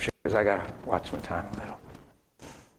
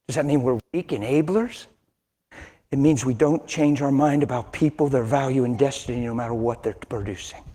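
ordinary delivery

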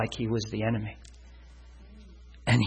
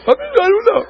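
much higher, louder sheepish blurt; flustered high answer; 8 dB louder than usual. Retold or imitated. Husband answering his wife's question, flustered high blurt. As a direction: blurt it out, caught off guard.

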